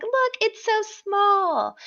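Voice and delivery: high, drawn-out "look how small" delivery; imitating someone showing a tiny trinket, slow and high; mock showing off. Housemate showing the tiny veto to camera, high coo. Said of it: "she's like".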